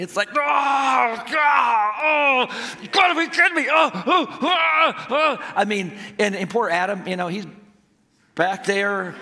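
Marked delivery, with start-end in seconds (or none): 0.3-5.5 high, loud re-enacted howls of pain; retold wailing. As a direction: wail as if your thumb is crushed, played up. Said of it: "I start just wailing".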